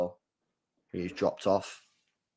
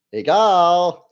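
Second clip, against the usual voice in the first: calling out; 5 semitones lower, 6 dB louder than usual. Real, not acted. Calling out a name.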